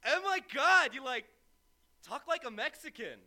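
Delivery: mock astonished stranger. Stranger gawking at his accent, mock astonishment. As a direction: imitate a stunned listener gawking at his speech.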